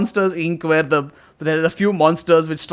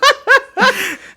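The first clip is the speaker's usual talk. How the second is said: shrill mocking laugh; high laugh; 4 dB louder than usual. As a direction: laugh it off loudly. Laughing at the ghost.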